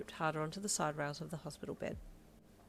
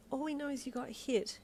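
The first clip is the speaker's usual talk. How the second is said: worried mum; 8 semitones higher than usual. Acted.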